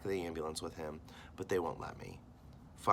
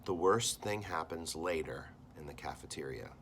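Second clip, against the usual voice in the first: plain reading voice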